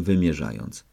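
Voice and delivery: usual speaking voice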